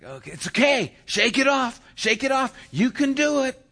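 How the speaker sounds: high, loud cheering of a player who missed; encouraging shout. Retold or imitated. Fans encourage a girl after her miss, high loud cheer. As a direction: shout it like a fan lifting a teammate.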